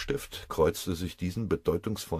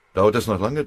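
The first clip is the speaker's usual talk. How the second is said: loud, higher impatient question; pressing; 4 semitones higher, 8 dB louder than usual. A narrator's reading. Lieutenant waiting for his plane, pressing impatiently. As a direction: push urgently for an answer. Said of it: "drängte der Leutnant".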